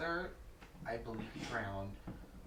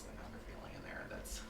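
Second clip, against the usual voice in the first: whispering; 6 dB quieter than usual. Retold or imitated. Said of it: "but I was like".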